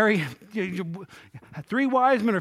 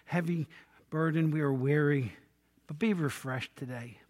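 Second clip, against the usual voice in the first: soft, hushed pastor's prayer; closing prayer; 4 semitones lower, 4 dB quieter than usual. Acted. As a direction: pray quietly and humbly over the church.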